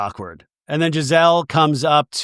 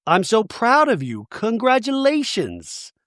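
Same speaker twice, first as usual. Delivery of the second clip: higher-pitched, drawn-out imitated praise; gushing congratulations; 6 semitones higher, slower than usual. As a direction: gush like a proud friend, acted out. Voicing supportive friends' congratulations.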